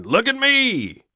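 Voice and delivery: high squealed look at me; mocking shout. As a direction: screech it like a show-off. Mocking attention seekers screaming.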